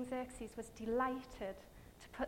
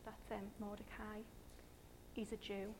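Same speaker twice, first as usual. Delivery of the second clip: hushed, much softer servant's answer; attendant's reply; 3 semitones lower, 14 dB quieter than usual. Acted. Attendant informing Haman, hushed and much softer. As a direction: tell it quietly, like a nervous servant. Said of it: "one of his attendants was like".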